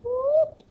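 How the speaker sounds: quick high, loud swooping whoop; playful high sound effect. Shown as a demonstration. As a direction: whoop like a line zipping across. Tracing the diameter across.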